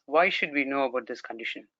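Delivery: ordinary talk, nothing marked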